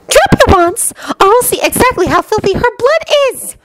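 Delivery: high, shrieking villainess threat; Bellatrix. A narrator's reading. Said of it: "Bellatrix's high voice shrieked".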